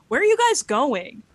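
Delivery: loud, high-pitched call to friends. Acted. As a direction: shout the question over to the group. Luna calls to departing friends.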